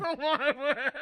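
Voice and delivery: high, laughing whine; laughing protest. Real, not acted. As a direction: laugh out the refusal. Laughing with candy in mouth.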